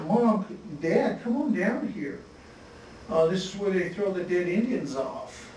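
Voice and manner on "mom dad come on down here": put-on high, light kid's voice calling across; imitating a small boy shouting for mom and dad; child calling out. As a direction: call down to your parents like a little kid. Kid calling to parents.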